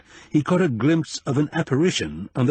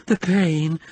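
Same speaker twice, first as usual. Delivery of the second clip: moaning; 7 semitones higher than usual. Acted. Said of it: "she moaned".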